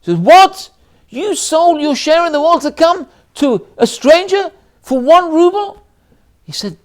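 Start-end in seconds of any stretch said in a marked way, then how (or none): 0.2-5.8 shrill, high, loud outburst of disbelief; furious wife. Retold or imitated. Wife hearing he sold his share in the world to come, furious shout. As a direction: explode at him in outrage. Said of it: "She's furious".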